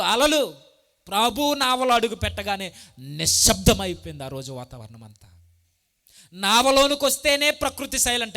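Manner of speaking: loud, rising preacher's cry; preaching at full force. Acted. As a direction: thunder it out to the congregation. (ఉద్వేగంతో గట్టిగా బోధించడం)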